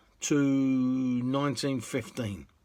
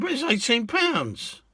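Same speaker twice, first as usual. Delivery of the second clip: higher, louder mock-outraged objection; a passenger balking at the price; indignant protest; 11 semitones higher, 4 dB louder than usual. Passenger protesting the fare to the cabbie, mock indignant.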